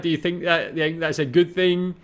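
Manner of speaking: ordinary talk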